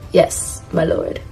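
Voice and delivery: soft, low-key butler's reply; Sebastian. Acted. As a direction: answer quietly like an obedient butler. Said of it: "this is him saying".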